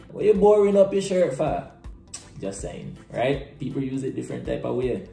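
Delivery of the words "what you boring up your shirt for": high, loud scolding parent. Retold or imitated. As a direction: snap at the kid like a parent. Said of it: "Parents would be like".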